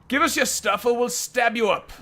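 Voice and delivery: high, loud thuggish threat; thug growl. Acted. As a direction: menace the hero like a dim bandit. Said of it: "growls one of them".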